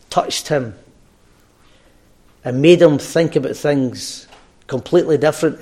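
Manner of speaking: ordinary delivery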